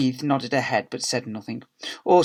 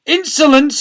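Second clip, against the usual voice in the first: high, outraged shout; 14 semitones higher, 12 dB louder than usual. A narrator's reading. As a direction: shout it in affronted fury. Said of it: "shouted the deputy head".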